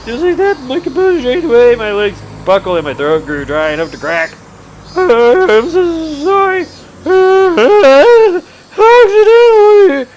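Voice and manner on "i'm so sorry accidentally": high, slow, wailing apology; scared boy pleading with the thugs; frightened plea. Acted. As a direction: beg for mercy, voice cracking.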